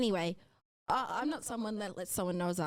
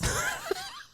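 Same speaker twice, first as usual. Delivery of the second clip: a burst of high, embarrassed laughter. Real, not acted. Embarrassed laugh after quote.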